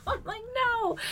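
very high, squeaky through real laughter; laughing. Real, not acted. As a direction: squeeze the words out while laughing. Laughing at her son's retold answer.

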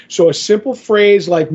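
usual speaking voice

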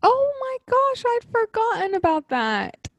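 high delighted gasp of recognition; thrilled to remember it; excited. Real, not acted. Sudden fond memory of book.

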